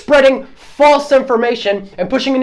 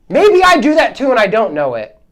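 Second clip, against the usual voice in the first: normal talking voice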